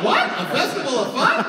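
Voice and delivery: high, loud mock disbelief; incredulous. Acts out his incredulous reaction.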